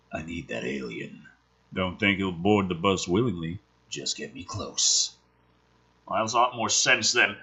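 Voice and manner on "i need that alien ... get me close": low Williams voice. Acted. Williams quoted in the script.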